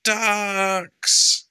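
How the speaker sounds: high, loud booming shout; super shout. Acted. Stephen calling the ducks, huge super shout. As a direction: bellow it across the pond. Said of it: "use your super shout".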